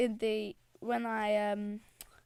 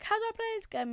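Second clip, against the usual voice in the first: high-pitched mimicry of a squeaky player; squeaky gamer; 8 semitones higher, much faster than usual. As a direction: pipe it up in a high squeak, mocking him. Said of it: "He's like a squeak".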